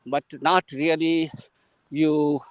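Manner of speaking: ordinary talk, nothing marked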